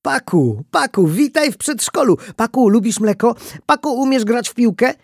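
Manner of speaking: put-on high, loud, excited kids' voices; squealing, enthusiastic child chatter; children's eager questions. A narrator's reading. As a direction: bombard the newcomer like a bunch of kids. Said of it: "Dzieci zasypywały mnie pytaniami".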